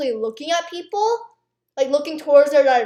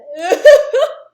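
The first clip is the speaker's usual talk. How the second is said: laughing; 6 semitones higher, 10 dB louder than usual. Real, not acted. Laughs at forgetting.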